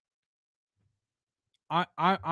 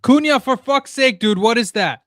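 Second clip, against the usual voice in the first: real angry shout at the screen, higher and louder; exasperated fan shouting at the match; furious yell; 6 semitones higher, 7 dB louder than usual. Real, not acted. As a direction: yell in disbelief at the player. Furious at player's mistake.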